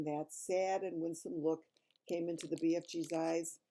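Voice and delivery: plain reading voice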